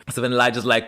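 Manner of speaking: normal talking voice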